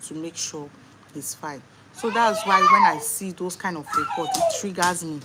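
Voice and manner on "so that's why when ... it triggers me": louder, heated outburst; upset. Real, not acted. Mother reacting to hospital negligence reports, heated and loud. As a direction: let the anger at negligence break through.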